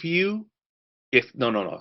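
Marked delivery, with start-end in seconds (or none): none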